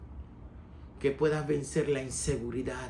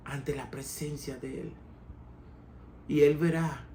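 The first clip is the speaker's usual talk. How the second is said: ordinary talk, nothing marked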